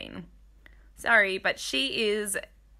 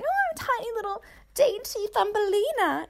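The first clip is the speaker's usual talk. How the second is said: tiny, much higher-pitched little voice; dainty Thumbelina voice; 12 semitones higher than usual. Acted. Anyone feeling tiny next to him, dainty high voice.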